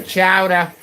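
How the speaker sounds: "chowder" drawn out as chow-dah, New England style; New England accent. Shown as a demonstration. Streamer just emphasizing the word, exaggerated New England accent. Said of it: "I'm just emphasizing the chowder".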